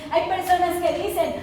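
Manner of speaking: ordinary talk, nothing marked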